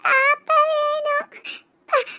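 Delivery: slow, high tearful plea; crying. Acted. Child demanding the baby from uncle, crying. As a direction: sob out the demand in drawn-out words. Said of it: "என் மவன் அழுகையை நிப்பாட்டு".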